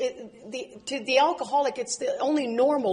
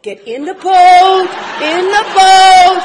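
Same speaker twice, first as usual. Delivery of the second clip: insistent urging shout; 8 semitones higher, 15 dB louder, slower than usual. Retold or imitated. Urging Ron, insistent repeat.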